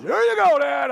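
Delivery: high, loud call across the course; triumphant kid calling out; excited shout. Retold or imitated. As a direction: shout it out proud to your dad. Son shouting after near hole-in-one.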